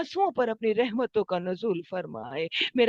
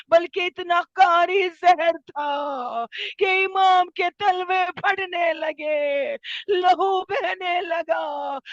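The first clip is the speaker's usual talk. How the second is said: majlis lament; 5 semitones higher, 4 dB louder than usual. Acted. Preacher telling mourners of the poisoned Imam, wailing lament. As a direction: cry the tale out in long mournful phrases.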